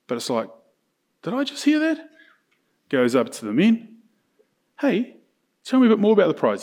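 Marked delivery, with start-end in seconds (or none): 1.2-2.1 high-pitched, ears suddenly pricked up; mock incredulous interest. David overhearing about the prize, mock surprised. 4.8-6.6 higher-pitched, eager mock inquiry; keenly interested David. David asking the men about the prize, playfully keen. David sidles up to ask more.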